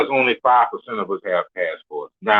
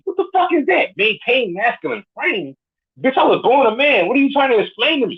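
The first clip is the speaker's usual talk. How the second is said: incredulous outrage; 9 semitones higher than usual. Real, not acted. Mocking a dating advice term.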